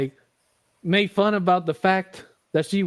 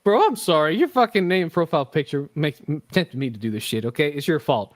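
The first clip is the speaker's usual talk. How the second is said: ordinary delivery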